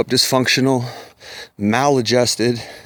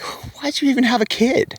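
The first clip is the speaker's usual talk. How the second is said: pitched way up in disbelief, whole line; incredulous outburst; 12 semitones higher than usual. Real, not acted. Ranting about bad fathers, incredulous and high. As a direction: throw the question up high, stunned.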